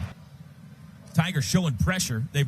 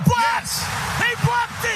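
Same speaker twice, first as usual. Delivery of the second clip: very high shout of the blocked kick; shouted high call, easing into wrestling report; excited shout; slower than usual. Real, not acted. Blocked kick called live.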